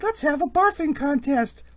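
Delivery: put-on high, loud child's squeal; excited little kid. Little kid challenging the party to barf, excited. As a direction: squeal it like a rowdy small kid.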